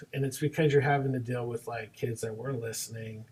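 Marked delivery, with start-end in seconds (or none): none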